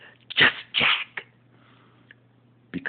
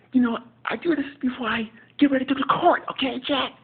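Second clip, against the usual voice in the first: much higher, louder exasperated sitcom voice; Will impression; 5 dB louder than usual. Will scolding Jack over his newspaper, high and loud. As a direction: scold a friend who interrupts your paper. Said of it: "my impersonation of Will".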